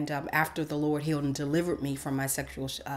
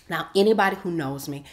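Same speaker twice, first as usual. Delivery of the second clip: ordinary talk, nothing marked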